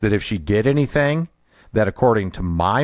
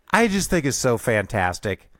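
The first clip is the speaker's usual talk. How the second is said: ordinary talk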